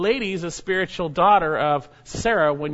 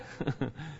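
soft chuckle; 2 semitones lower, 12 dB quieter than usual. Real, not acted. Laughs at his own joke.

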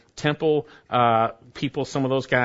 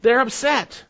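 high, loud, rising to a passionate peak; preaching climax; 13 semitones higher, 6 dB louder than usual. Real, not acted. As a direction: build up the outrage, louder and higher.

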